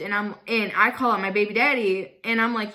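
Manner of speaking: ordinary talk, nothing marked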